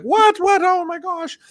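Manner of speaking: very high, acted panicked squeal; hammed-up squeaky alarm; freaked out. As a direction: play your younger self panicking. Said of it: "I was all freaked out".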